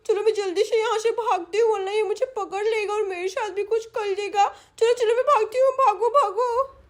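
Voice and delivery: put-on high-pitched childish panic; small lisping girl urging herself to run; fleeing little girl. Little girl flees the thief.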